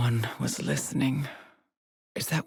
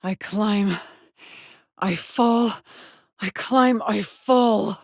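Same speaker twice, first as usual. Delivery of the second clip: high, effortful, growing louder; straining effort; 5 semitones higher, 5 dB louder, slower than usual. Acted. Explorer climbing and falling repeatedly, straining harder. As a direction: push each short phrase out with rising strain.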